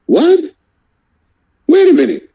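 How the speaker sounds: high, shocked what then wait a minute; can't believe what he's hearing; stunned disbelief. Real, not acted. Host hearing Canelo is franchise champion, stunned disbelief.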